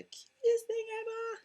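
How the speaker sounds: put-on high doting coo over her baby; gushing baby talk. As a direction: coo it like a proud mum. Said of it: "I know I'm biased".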